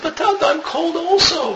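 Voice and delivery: put-on high, whimpering child's voice; crying little child. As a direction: play a small boy crying to his dad. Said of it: "And he's crying to his father".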